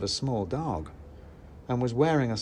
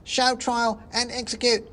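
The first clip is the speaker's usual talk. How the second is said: very high squeaky demand; third shouting mouse; 12 semitones higher, 4 dB louder than usual. Acted. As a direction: egg the mob on. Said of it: "shouted a third".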